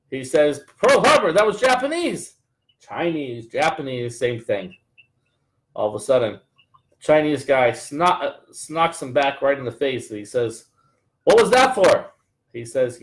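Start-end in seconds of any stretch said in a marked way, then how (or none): 0.8-2.3 high, outraged objection; a joke character squeaks his indignation; indignant protest. Retold or imitated. Joke, man protests the punch. 11.2-12.1 indignant yell. Retold or imitated. Joke, man punched in face.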